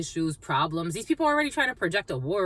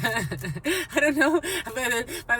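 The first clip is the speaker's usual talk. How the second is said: laughing; 5 semitones higher, much slower than usual. Real, not acted. Laughing about fears.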